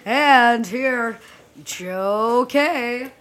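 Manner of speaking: loud Saturday Night Live announcer intro. Retold or imitated. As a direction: boom it out like a TV announcer. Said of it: "it's like the Saturday Night Live".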